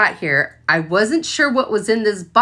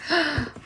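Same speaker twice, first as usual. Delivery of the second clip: excited gasp; 6 semitones higher, 7 dB quieter than usual. Real, not acted. Opening her new warmer.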